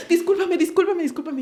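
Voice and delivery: high, pleading sorry-sorry; a friend overwhelmed by her baby, begging sorry on a video call; mimicked tearful apology. Friend apologizing while crying on call.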